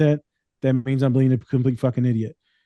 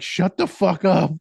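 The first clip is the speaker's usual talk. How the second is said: embarrassed retort; 5 semitones higher, slower than usual. Retold or imitated.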